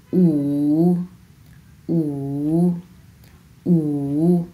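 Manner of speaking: "উ" in a dipping third tone. Shown as a demonstration. Teacher modelling Chinese five, third tone. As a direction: let "উ" sink and wave, drawn out. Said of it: "এটা হচ্ছে তিন number tone".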